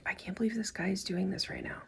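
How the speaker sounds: breathless secret whisper between friends; whispered aside. Retold or imitated. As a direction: whisper it so the waiter can't hear. Friends whispering about rude waiter.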